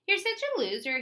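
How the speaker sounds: high, loud mocking sneer; clucking bully taunt. A narrator's reading. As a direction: taunt her like a schoolyard bully. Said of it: "he clucked at Rose".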